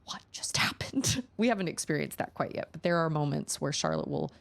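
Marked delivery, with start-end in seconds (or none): 0.1-1.2 slow, bewildered whisper; soft, slow whispered disbelief; stunned whisper. Retold or imitated. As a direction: breathe it out, dumbfounded. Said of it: "you're left going".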